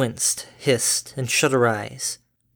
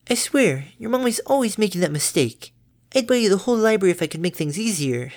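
high, bright-natured mother pony; doting mom, high and chirpy; cheery mother voice; 9 semitones higher than usual. Acted.

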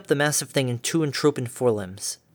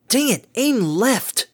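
drawn-out, louder frustrated command; slow exasperated yell; 10 semitones higher, 5 dB louder, slower than usual. Acted. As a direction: yell it again, fed up and slow. Repeated order, more desperate.

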